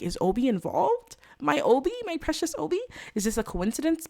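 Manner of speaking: high, rising alarm; shocked disbelief. Retold or imitated. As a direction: gasp out the betrayal, high. Suspicious player voicing herself.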